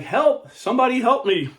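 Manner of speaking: very high, loud plea for help; acted shout. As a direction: scream for rescue as the trapped man. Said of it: "he started shouting out".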